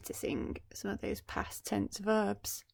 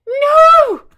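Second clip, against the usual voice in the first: very high, loud anguished no; the queen crying out at the curse; 23 dB louder than usual. Acted. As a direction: scream in horror. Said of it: "cried the queen".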